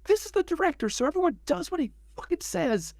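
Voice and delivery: high-pitched mock yell to a crowd; retold yell. Drummer announcing the director to whole warehouse, mock yell. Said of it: "he yells to the whole warehouse".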